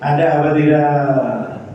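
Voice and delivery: ordinary talk, nothing marked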